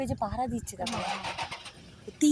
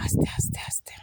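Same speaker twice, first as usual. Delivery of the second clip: repeated forceful whisper; urgent whispered caution. Real, not acted. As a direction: hiss a whispered warning to go gently. Warning others to stay quiet. (ফিসফিস করে সাবধান করা)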